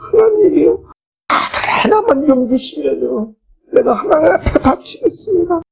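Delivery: high-pitched, acted-out weeping plea; imitated tearful prayer. Preacher voices childless Hannah begging God for a son, imitated tearful prayer. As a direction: plead to God as a crying supplicant, pitched far up. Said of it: "울면서 기도한 겁니다".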